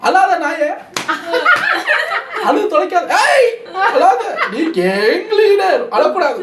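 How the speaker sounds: toughen yourself up like a comic scolding his own tears; mock self pep talk. [name] alone in the bathroom scolding herself to stop crying, mock fierce. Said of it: "Vivek மாதிரி தன்னைத்தானே strong ஆக்கிக்கிறாங்க".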